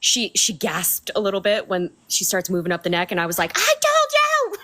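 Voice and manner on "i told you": triumphant exclamation. Retold or imitated. Retelling shouting at her mom.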